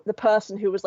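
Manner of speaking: usual speaking voice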